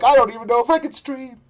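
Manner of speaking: high, quick mock complaint; mocking whine. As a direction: imitate her helplessness in a high voice. Mocks what co-host said.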